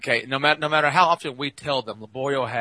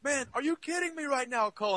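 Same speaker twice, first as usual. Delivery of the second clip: amazed; 13 semitones higher, 11 dB quieter than usual. Retold or imitated. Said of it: "Laborio goes".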